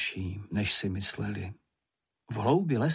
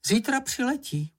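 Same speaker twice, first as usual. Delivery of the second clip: excited announcement; 13 semitones higher than usual. A narrator's reading. Character's high, animated announcement.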